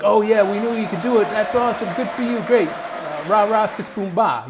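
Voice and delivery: high, fast gushing cheerleader voice; rah-rah pep squad praise. Acted. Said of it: "the cheerleader's voice".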